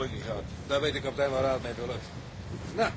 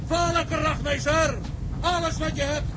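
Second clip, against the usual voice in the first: called-back order; 10 semitones higher, 3 dB louder, slower than usual. Acted. Repeating order at sea.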